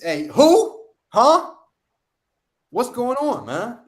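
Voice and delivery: high, loud startled yelp; alarmed Isaac. Retold or imitated. Blind father startled by the second son, loud and high. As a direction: jump in alarm at the stranger.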